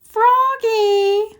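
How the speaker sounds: high, long drawn call of Froggy; mother calling from downstairs, high drawn-out call. A narrator's reading. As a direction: call Froggy from another room. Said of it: "called his mother".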